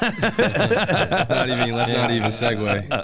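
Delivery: laughing it off, slowly. Real, not acted. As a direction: laugh through the words, waving the topic away. Laughs off the Framingham scandal.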